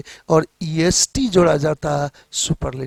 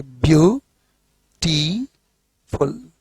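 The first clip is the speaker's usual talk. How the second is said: "Beautiful" broken into three slow, separate beats; syllable by syllable; 3 semitones lower, much slower than usual. Shown as a demonstration. Teacher showing students three syllables, "beautiful" said syllable by syllable. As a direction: draw out "beautiful" in three parts with clear gaps. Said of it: "तीन step पर आप जोड़ रहे हैं".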